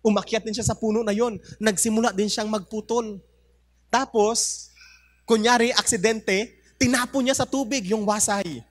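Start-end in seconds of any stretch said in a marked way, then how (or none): none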